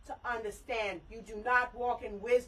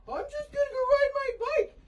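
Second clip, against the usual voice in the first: high, whiny mock of a lazy teen; sing-song mock of a spoiled daughter's excuse; 12 semitones higher, faster than usual. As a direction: mimic her like a bratty kid brushing it off. Mom mocking daughter's excuse.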